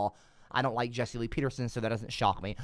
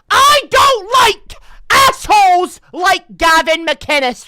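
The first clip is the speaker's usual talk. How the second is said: much higher, much louder angry yelling; furious rant; 11 semitones higher, 15 dB louder, slower than usual. Real, not acted. Streamer yelling at Gavin McInnes and his trolls, furious. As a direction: blast the trolls who want to clown on you.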